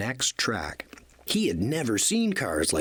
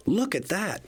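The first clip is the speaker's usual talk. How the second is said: higher, awed look at that; a touch of breathless wonder; little engine amazed at the shark; amazed gasp; 8 semitones higher than usual. A narrator's reading. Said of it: "Thomas gasped".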